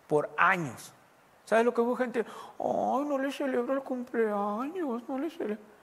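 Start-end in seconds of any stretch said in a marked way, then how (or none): 2.6-5.7 higher, slow, whiny mock of a careless mother. Preacher mocking a mother who skips birthdays, whiny and slow. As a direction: draw it out like someone who can't be bothered.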